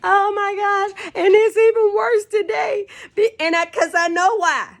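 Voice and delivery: high-pitched, squealing through laughter; laughing. Real, not acted. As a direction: talk through a fit of laughter, voice way up. Laughing over her own fears.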